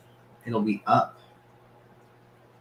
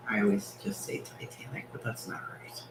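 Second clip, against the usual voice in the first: whispered aside; 12 dB quieter, faster than usual. Real, not acted. Host whispering an aside to the chat about names, hushed. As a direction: drop to a breathy whisper.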